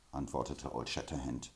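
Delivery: plain reading voice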